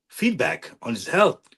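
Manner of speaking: ordinary delivery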